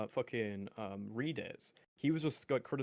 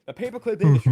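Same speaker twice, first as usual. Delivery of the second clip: pushed louder, higher; louder [name] impression; 5 semitones higher, 12 dB louder than usual. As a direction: ramp up the impression.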